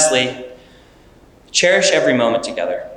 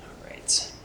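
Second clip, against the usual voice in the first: whispering; 9 dB quieter, much slower than usual. Real, not acted. Whispered aside during ring exchange.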